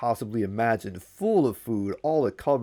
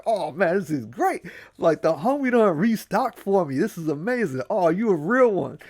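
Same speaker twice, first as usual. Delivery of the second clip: high happy gushing; Anansi thrilled; 7 semitones higher than usual. Acted. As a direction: gush over the restocked food. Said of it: "He's like".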